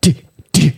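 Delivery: short, spaced "di" beats; imitated beat drop. Shown as a demonstration. Showing friends how the track dropped, imitated beat. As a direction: mouth the broken drop beat by beat. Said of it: "Zenin droppas näin".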